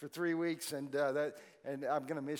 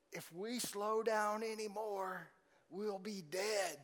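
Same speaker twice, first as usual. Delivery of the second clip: slow, lightly put-on Southern US drawl; a hint of a slow old Southern man; a slow Southern drawl, only lightly done; mock Southern drawl; 2 semitones higher, 3 dB quieter, slower than usual. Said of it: "he didn't have a southern accent and drawl like that".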